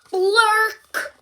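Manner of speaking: high, loud brassy blurt into the trumpet. Acted. Piggy blows her trumpet for Gerald, a loud honk. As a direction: blast a bad trumpet note.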